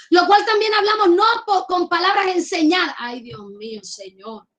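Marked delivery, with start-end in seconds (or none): 3.0-4.5 breathy, much softer sigh to God; a private plea to the Lord, barely voiced; hushed prayer. Real, not acted. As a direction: murmur it under your breath. Preacher's whispered aside prayer.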